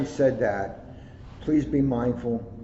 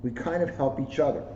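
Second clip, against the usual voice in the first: put-on bright, lively storybook read in a child's voice; reading to children; 4 semitones higher, 3 dB louder than usual. A narrator's reading. Officer reading a picture book to schoolkids, lively storybook voice. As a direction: read it like a proud kid telling his story. Reads kids' book aloud.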